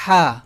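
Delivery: ہا with an open aa ending; Arabic ha. Shown as a demonstration. Tajweed teacher demoing ہا to the class. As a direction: voice ہا from the throat, long aa. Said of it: "اس ہا کے لیے اب اگر آپ note کریں".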